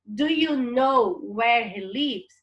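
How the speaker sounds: slow, deliberate English sentence; slow classroom reading of the English question; slow model reading. Shown as a demonstration. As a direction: read it slowly so students follow. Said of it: "E depois".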